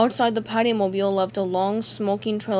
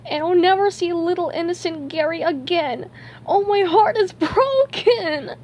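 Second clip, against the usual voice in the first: high-pitched, hammed-up weeping; crying; 9 semitones higher, 3 dB louder than usual. A narrator's reading. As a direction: wail it tearfully in a high cartoon voice.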